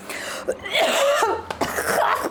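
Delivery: high choking cough; coughing from chili fumes. Real, not acted. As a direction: cough on the spicy air. Chili in the air.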